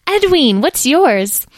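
put-on very high child's voice; eager child. A narrator's reading. Child introduces themselves to a fairy, eager and high. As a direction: answer brightly as a keen youngster. Young Edwin answers in high voice.

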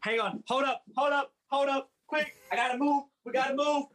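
high, barking drill sergeant commands. Acted. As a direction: shout it like a hyped drill instructor. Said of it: "do it in a drill sergeant voice".

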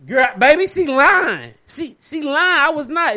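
loud, emphatic she lying; loud insistence. Real, not acted. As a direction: call the lie out loudly. Mocking a girl's denial.

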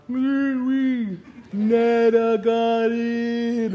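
high, drawn-out slow-motion holler. Retold or imitated. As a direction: stretch every word like slowed film. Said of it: "all slow motion cloud in my head".

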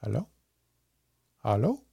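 put-on eerie soft "hello" in a light German accent; a ghostly caller, softly, German accent, questioning lilt; soft German-accented woman. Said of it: "soft female voice with a ver-, with a German accent".

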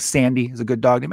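ordinary delivery

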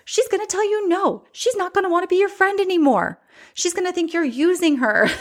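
high-pitched nagging little voice in her head; squeaky voice of self-doubt; piping inner voice. Acted. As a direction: scold in a high, incredulous little voice. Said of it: "that little voice piped up".

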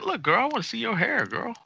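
high, crooning flirt voice; flirty lover voice. Pretend. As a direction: croon it like a smooth talker.

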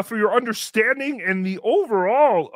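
ordinary talk

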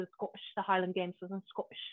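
badly faked Scottish accent; mock Scottish accent. Host claiming Scottish roots to guest, joking Scottish accent. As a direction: put on a dodgy Scottish burr. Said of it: "The accent gives it away".

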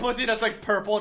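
ordinary talk

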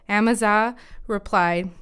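ordinary delivery, nothing marked

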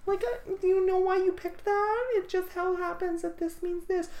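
put-on very high ditzy girl voice; dumb blonde voice. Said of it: "your girl voice ... dumb blonde".

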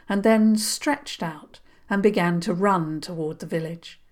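usual speaking voice

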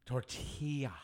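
"Tortilla" said tor-TEE-ya; Spanish-style "Tortilla"; Y sound. Shown as a demonstration. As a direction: make the LL in "Tortilla" a Y. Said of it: "You gotta make the Y sound".

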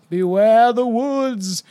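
slow, ominous warning; a stranger warning travelers, slowly; ominous hooded stranger. Acted. Repeats line in ominous read.

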